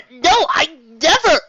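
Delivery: high, loud, slow pleading no; desperate denial. Acted. Rainbow Dash denies abandoning team, high slow protest. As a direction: protest in panic. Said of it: "she started".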